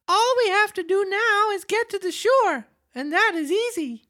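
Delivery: put-on joyful wooden puppet boy; jerky creaky wooden puppet. Reads Pinocchio's lines.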